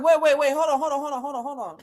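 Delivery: high, rapid-fire repeated halt; string of urgent hold-ons, losing volume; interrupting. Retold or imitated. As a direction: cut in frantically, then tail off. Story, interrupting Manuel's rant.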